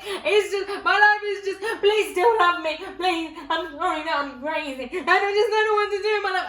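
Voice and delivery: high, loud mock sobbing plea; hammed-up wailing. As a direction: wail it like a melodramatic breakdown in the shower. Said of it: "I was crying to my girlfriend about it".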